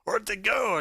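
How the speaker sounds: high panicked yell of a startled guard; panicked shout. Acted. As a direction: shout it out in sudden alarm. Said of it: "he's like".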